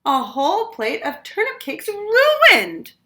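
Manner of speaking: put-on high, slow, loud child's groan; a little boy whining over ruined cakes. A narrator's reading. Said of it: "groaned little Chan".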